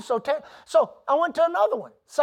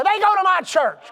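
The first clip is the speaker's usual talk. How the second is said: higher, louder teasing call-out; mock calling out; 7 semitones higher, 6 dB louder than usual. Preacher singling out a member to the crowd, mock call-out. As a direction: point them out to the room, loud and playful.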